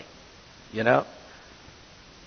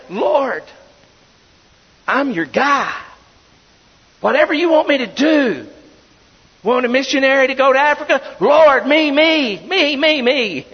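louder, higher, eager to be picked; eager volunteer; 9 semitones higher, 6 dB louder than usual. Retold or imitated. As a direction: throw up your hand, begging to be chosen. Eager volunteer shouting to God.

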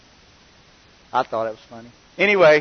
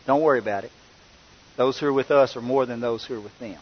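calm reassurance; 5 semitones lower, 6 dB quieter, faster than usual. Retold or imitated. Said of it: "And what does Elisha say?".

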